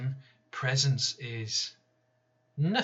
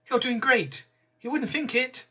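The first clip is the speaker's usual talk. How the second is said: much higher, sing-song reassurance; breezy pitched-up platitude; mock-cheery visitor; 11 semitones higher than usual. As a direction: imitate their hollow encouragement. Mimicking well-meaning visitors.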